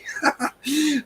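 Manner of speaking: a high burst of laughter through tears; real laugh after crying. Real, not acted. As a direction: let a squeaky happy laugh out.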